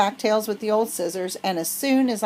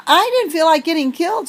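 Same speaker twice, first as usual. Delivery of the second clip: indignant; 8 semitones higher, 5 dB louder than usual. Acted. Indignant reply after escape.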